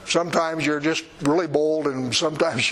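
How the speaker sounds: usual speaking voice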